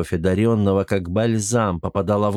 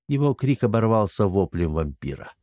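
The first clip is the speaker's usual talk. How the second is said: usual speaking voice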